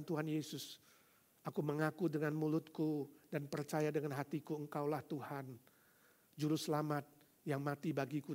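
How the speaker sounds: hushed sinner's prayer; quiet prayer. Acted. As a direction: lead them gently in prayer. Leading a prayer softly.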